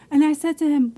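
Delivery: normal talking voice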